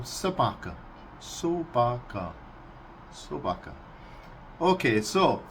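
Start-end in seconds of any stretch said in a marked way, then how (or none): 0.0-0.7 собака said carefully in Russian, so-BA-ka; Russian word. Shown as a demonstration. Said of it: "as the Russians call them". 1.2-2.3 собака drawn out slowly, so-BA-ka; slow Russian word. Shown as a demonstration. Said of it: "as the Russians call them". 3.1-3.7 собака said softly, falling away; a quiet repeat of Собака; soft Russian word. Shown as a demonstration. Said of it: "as the Russians call them".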